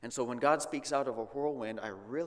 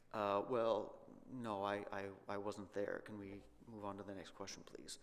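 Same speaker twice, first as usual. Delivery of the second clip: sheepish Job; 4 semitones lower, 7 dB quieter than usual. Acted. Job sheepishly dodging God's question.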